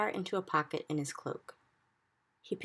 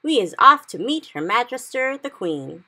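higher, loud eager call; excited shout; 8 semitones higher, 13 dB louder than usual. Acted. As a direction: announce it with gusto. BFG excited setting off.

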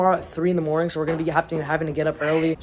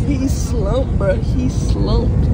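teasing; 6 semitones higher, 6 dB louder, much slower than usual. Real, not acted. Teasing sleeping friend.

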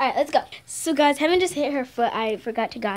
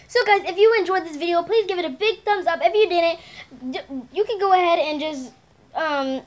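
upbeat, fast youtuber sign-off; vlog outro; 4 semitones higher, faster than usual. Acted. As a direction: rattle off the channel plug.

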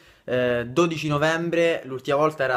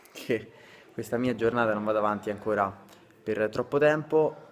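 normal talking voice

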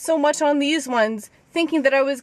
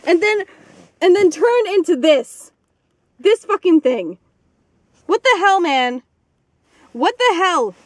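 indignant; 5 semitones higher, 6 dB louder than usual. Real, not acted. Revealing big hole, indignant.

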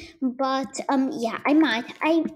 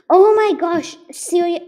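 startled; 8 semitones higher, 11 dB louder than usual. Real, not acted. Route going wrong.

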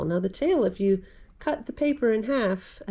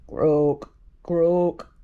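frog croak; 5 dB louder than usual. Acted. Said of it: "said Frog".

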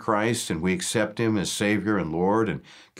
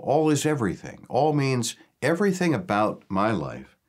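ordinary talk

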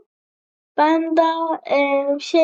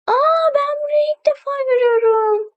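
amazed; 9 semitones higher than usual. Real, not acted. Amazed at new game area.

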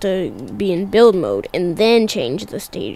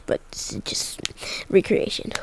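soft unvoiced whisper; 3 semitones lower, 10 dB quieter than usual. Real, not acted. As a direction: whisper it like a secret aside. Aside after voice remark.

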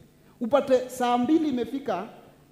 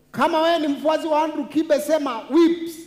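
crowd hype shout; 5 semitones higher, 6 dB louder than usual. Acted. Host hypes crowd call-out.

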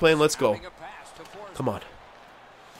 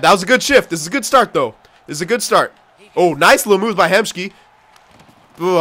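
usual speaking voice

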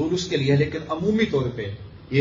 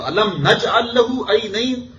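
high, slow, drawn-out Arabic verse; fervent Quran recitation; 9 semitones higher, 3 dB louder, slower than usual. Shown as a demonstration. Preacher driving the verse home, fervent high recitation. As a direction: recite it out with raised pitch.

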